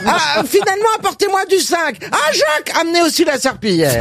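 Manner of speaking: very high amazed call to the back; amazed shout. Retold or imitated. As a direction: shriek for Jacques in disbelief. Pharmacist's final amazed call.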